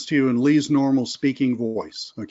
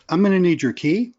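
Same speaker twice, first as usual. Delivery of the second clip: higher, friendly asking tone rising at the end; friendlier; 5 semitones higher than usual. Shown as a demonstration. Second valet asking the driver for the key in a friendly way. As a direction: ask for the key pleasantly. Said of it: "The second one was friendlier".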